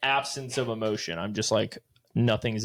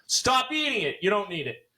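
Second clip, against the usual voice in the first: much higher, sharp mock scolding to the listener; 10 semitones higher than usual. Host telling zinc-poisoned listeners off in a high mock scold. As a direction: snap at the listener in a high put-on voice.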